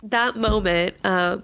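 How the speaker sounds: ordinary talk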